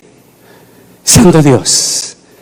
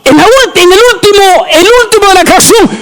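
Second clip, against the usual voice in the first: preaching at full force. Acted. Preacher crying out to his congregation in full force.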